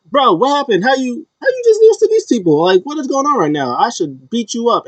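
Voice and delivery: high-pitched, incredulous scolding; acted-out scolding, pitch leaping way up; exasperated disbelief. Acting out Randy berating his partner.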